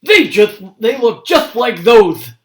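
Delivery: high, loud suspicious accusation; suspicious growl. Acted. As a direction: accuse him as a suspicious old man. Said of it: "growled suspiciously".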